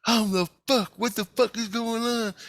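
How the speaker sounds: high-pitched mock bewilderment; put-on high voice, confused; mimicked bewildered priestess. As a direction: imitate a woman's baffled outcry up high. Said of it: "And she goes".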